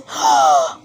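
sharp breathless gasp of alarm; shocked gasp. A narrator's reading. Narrator voicing Pinocchio's shock, sharp breathless gasp. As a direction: gasp as if seeing donkey ears. Boy finds donkey ears.

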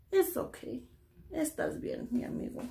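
soft and tender, comforting a sad friend; soothing. Pretend. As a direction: hush it gently, like calming a crying child. Comforts the sad ice cream.

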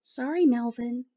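ordinary delivery

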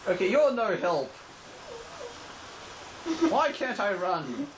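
normal talking voice

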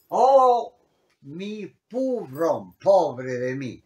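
a loud sighing Oh, then a slow, drawn-out mi pobrón; mock lament. Shown as a demonstration. Teacher acting a self-pitying sigh for the class. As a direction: groan it like a poor man pitying himself. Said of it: "Pobrón es pobre hombre".

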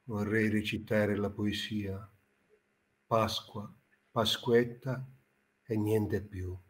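solemn, soft pompous poet; pompous poet introduction. Acted. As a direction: announce your poems with mock gravity. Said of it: "entrato nel personaggio".

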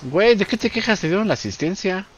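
high-pitched teasing protest; high teasing exclaim. Real, not acted. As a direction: raise the voice in mock outrage. Teasing friend over assist.

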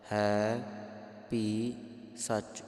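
ਹੈ ਭੀ ਸੱਚ as three separate held words; correct separated words. Shown as a demonstration. Teacher models for congregation, spaced-out ਹੈ ਭੀ ਸੱਚ. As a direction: pause after ਹੈ and after ਭੀ. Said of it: "ਇਸ ਪ੍ਰਕਾਰ ਇਸ ਪਾਠ".

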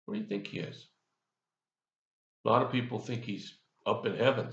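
ordinary talk, nothing marked

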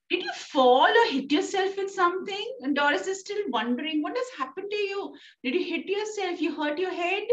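high, worried daughter's questions; shocked daughter. Acted. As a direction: voice the alarmed girl, pitched well up. Doris shocked at mother's behaviour.

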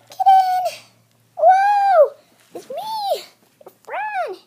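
very high put-on voice, loud at first; squeaky friend voice. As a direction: play the friend in a tiny high voice. Kid voicing a friend character.

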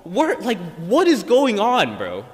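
what is going on, cry out in disbelief; bewildered. Retold or imitated. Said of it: "So I'm like".

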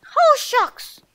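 high squeaky cartoon mouse voice; Mickey Mouse voice. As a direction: pitch up into a cheery cartoon mouse. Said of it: "That's me, Mickey Mouse".

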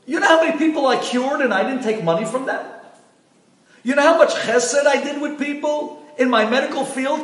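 dead husband screaming at the heavenly court, frantic; desperate screaming. Acted. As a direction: plead for your life, voice cracking up high. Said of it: "started screaming to the Bedin".

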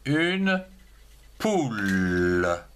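put-on teacher dictating une poule to children, slow; slow dictation. Shown as a demonstration. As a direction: dictate une poule with a gap between the words. Teacher dictates word for pupils.

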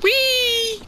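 very high gleeful whoop; excited squeal. Real, not acted. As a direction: squeal it like a ride. Falling down a hill.

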